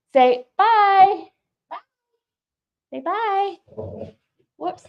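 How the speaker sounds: high singsong farewell; coaxing goodbye. Real, not acted. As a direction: prompt a little one to say bye. Prompting toddler to wave goodbye.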